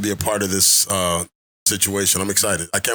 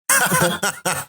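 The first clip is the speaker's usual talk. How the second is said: amused laugh; 8 semitones higher than usual. Real, not acted. Laughs after the shoutout list.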